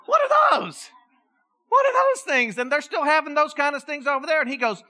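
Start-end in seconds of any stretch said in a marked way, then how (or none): 0.0-2.6 high, loud mock confusion; mock bafflement. Pastor retelling how he reacted to a question about mask rules, loud and baffled. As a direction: play dumb, loudly. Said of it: "I just like".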